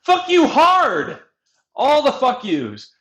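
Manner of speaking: high, loud, slow shout; shouted insult. Retold or imitated. Player escalates his insult to the government, loud slow shout. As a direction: yell it out long and high. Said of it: "escalates the insult".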